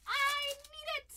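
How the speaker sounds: high, slow cartoon sponge pleading; SpongeBob voice. Pleading to the breaker for a hit in a SpongeBob voice. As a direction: beg for the card like SpongeBob. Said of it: "I read that in the SpongeBob voice".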